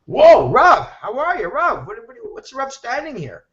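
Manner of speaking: high, excited surprise at seeing him; surprised greeting. Retold or imitated. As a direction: greet him like you can't believe your eyes. Surprised to meet the Rav.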